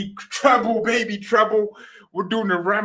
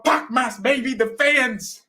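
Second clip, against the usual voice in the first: ordinary delivery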